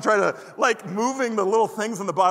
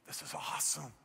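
hushed, much softer breathless awe; awed whisper; 4 semitones lower, 15 dB quieter than usual. Retold or imitated. Boy opens his Christmas football set, awed whisper. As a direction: whisper it like a wide-eyed child.

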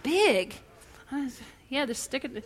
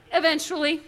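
ordinary talk, nothing marked

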